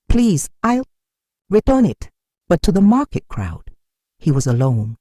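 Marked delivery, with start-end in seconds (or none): 0.1-2.1 higher, pleading plea; begging. A narrator's reading. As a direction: beg them to stop the whipping. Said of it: "He begged".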